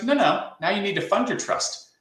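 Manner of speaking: a touch of the patient attorney correcting him; mimicking the lawyer. Estate attorney voiced in story.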